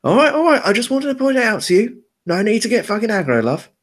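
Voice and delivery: defensive hoodie guy. Acted. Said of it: "just goes".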